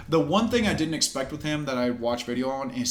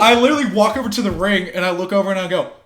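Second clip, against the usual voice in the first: normal talking voice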